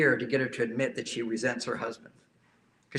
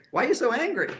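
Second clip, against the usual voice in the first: high, louder pleading question; mock wife asking; 9 semitones higher, 5 dB louder, faster than usual. Imitating the wife asking her husband, high question. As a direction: pitch it up as the wife.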